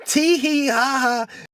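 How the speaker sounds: high, slow sing-song mock giggle; mimicking people who laughed at him. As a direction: taunt them with a silly tittering tee hee ha ha. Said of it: "laughing and shit".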